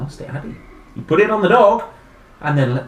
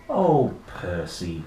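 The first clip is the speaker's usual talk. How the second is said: tender; 8 semitones lower, slower than usual. Acted. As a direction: say it tenderly like Mum. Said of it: "said his mum".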